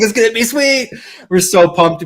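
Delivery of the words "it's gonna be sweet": put-on high, eager kid voice; excited kid. Little boy bragging to his buddy, high and excited. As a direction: gush like a pumped little boy. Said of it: "I'm like".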